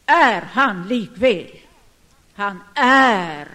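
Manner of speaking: loud, slow insistence; preacher's forceful affirmation; emphatic proclamation. Real, not acted. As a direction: hammer it home, loud and drawn out. Preacher insists God exists.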